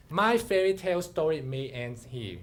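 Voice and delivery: plain reading voice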